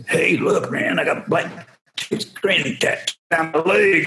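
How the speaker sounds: mock Daryl. Voicing Daryl showing tattoo.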